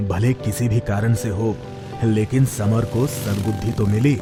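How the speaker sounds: ordinary delivery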